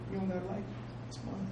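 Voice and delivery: demo reply. Shown as a demonstration. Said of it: "You say".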